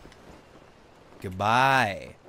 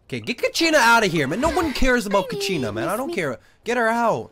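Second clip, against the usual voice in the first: higher, louder, fast ranting; mock outrage; 8 semitones higher, 5 dB louder than usual. Streamer to chat, loudly demanding Kachina be removed, hammed-up outrage. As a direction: shoo her off the stream in hammed-up fury.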